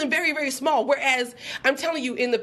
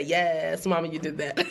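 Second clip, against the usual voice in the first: lower, laughing read of a comment; low laughing quote; 7 semitones lower than usual. Retold or imitated. Repeating a viewer's praise with a low laugh, playfully. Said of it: "but you did say".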